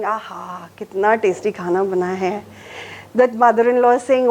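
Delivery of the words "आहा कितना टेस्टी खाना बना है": soft, low murmur of relish; lightly voiced spouse praising the food; appreciative spouse. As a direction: savour it quietly, then quick praise. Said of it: "The spouse is saying".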